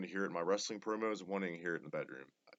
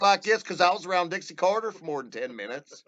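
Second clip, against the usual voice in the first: mocking exaggerated Southern drawl, pitched higher and louder; Southern twang impression; 7 semitones higher, 7 dB louder than usual. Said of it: "she didn't have that drawl".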